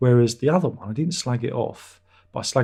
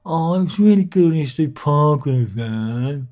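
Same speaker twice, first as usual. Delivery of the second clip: higher, slow, whiny imitation of a pestering fan; a teasing put-on fan voice, higher and slow; mocking fan voice; 5 semitones higher, 6 dB louder, slower than usual. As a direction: drag out the name like a fan moaning at you. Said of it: "I imagine that's how they talk".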